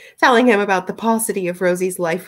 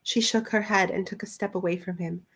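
ordinary delivery, nothing marked

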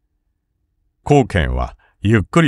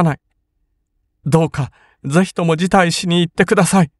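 high voice, pleading through tears; tearful pleading; 8 semitones higher than usual. A narrator's reading. The sister pleads with her brother to withdraw from the duel, tearful. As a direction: urge him gently but desperately. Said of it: "彼は涙をこぼしながらそう口説いた".

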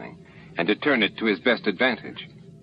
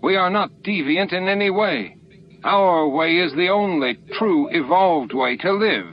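high, somewhat louder indignant outburst; yelling; 9 semitones higher, 3 dB louder than usual. A narrator's reading. Pakat defending his people to Sisko, high indignant yell. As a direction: protest hotly, voice pitched up high. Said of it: "Pakat yelled".

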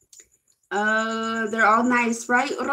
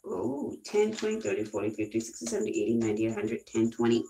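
low soft mumbled counting; tally the cost to herself quietly; counting under breath; 12 semitones lower, 7 dB quieter, faster than usual. Real, not acted.

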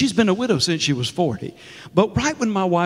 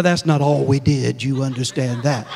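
usual speaking voice

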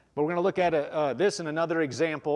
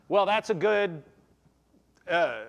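ordinary delivery

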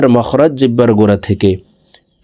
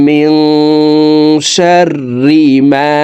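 high, slow chant with qalqala on খলাক; Quranic recitation; 5 semitones higher, much slower than usual. Shown as a demonstration. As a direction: stretch and bounce the final ক. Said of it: "ধাক্কা দিয়ে সাকিন করতে হবে". (উঁচু সুরে কলকলা সহ তিলাওয়াত)

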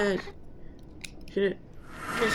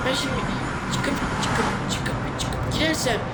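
exasperated; 7 semitones higher, 4 dB louder, slower than usual. Real, not acted. Frustrated at repeated glitch.

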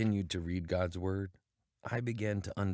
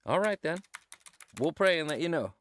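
higher and louder, speaking up over the line; loud phone voice; 8 semitones higher, 5 dB louder than usual. Acted. Missionary answering a caller from Uganda, loudly over the phone. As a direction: project so the far caller hears.

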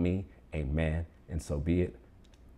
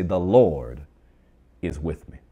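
louder bold declaration; 7 dB louder than usual. Shown as a demonstration. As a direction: LORD almost shouted, then the rest drops back. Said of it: "Come on, bonus time".

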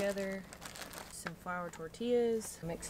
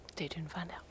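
soft unvoiced whisper; 3 dB quieter than usual. Real, not acted. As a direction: whisper it to the camera. Whispering at quiet campsite.

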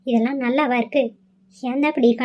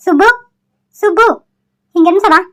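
raised, rising summoning call; calling out loudly; 4 semitones higher, 4 dB louder than usual. Acted. Calling the missing servant through the house, loud and searching. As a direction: call out for someone in another room. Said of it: "இத்தனை சத்தம் போடுற".

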